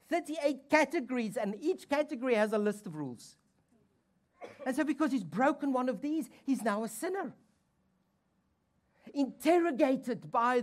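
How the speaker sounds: loud, high preacher's drive; full-force preaching. Acted. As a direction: hammer the point home to the church.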